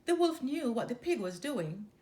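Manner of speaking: usual speaking voice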